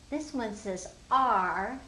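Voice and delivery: slow deliberate teacher voice; slow and clear; slow explaining. Shown as a demonstration. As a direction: spell it out slowly for kids. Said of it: "They would say".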